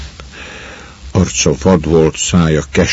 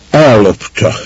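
groaned out; 5 semitones higher, 5 dB louder than usual. A narrator's reading. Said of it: "nyögte ki a legény".

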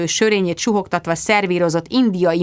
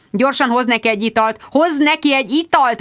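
howling; 6 semitones higher than usual. Acted. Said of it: "vonította Jude".

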